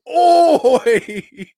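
a sudden "Oi!" yelled high and loud. Retold or imitated. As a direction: bark out "Oi!" like a caricature guard. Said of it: "They just go around saying".

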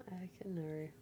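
breathy whisper. Real, not acted. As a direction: say it under your breath. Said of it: "whispered repeat".

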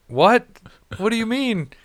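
high, puzzled what-do-you-mean; confused surprise. Retold or imitated. Friend asked about the weather, answers in high confused surprise. Brendan confused by snow question.

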